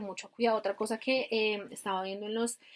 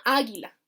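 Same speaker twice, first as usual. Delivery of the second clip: águila answered with a long stressed first A; stress on first syllable; 3 dB louder than usual. Retold or imitated. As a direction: say águila as AAH-gui-la. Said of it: "¿dónde va el acento?".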